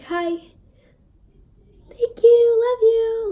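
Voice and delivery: high, loud, squeaky fan voice; starstruck squeak. Retold or imitated. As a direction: squeal it like a dazed superfan. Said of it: "I was just like".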